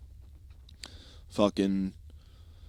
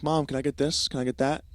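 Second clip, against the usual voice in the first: put-on higher-pitched eager child voice; pleading kid; 6 semitones higher than usual. Kid asking mom for cakes, pleading child voice. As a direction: imitate a kid asking for treats. Child begging parent in bakery.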